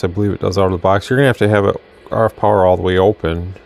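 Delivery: ordinary delivery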